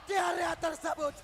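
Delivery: loud, high hype call; fighting game announcer. Real, not acted. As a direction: call the teamfight at full volume. Teamfight play-by-play hype.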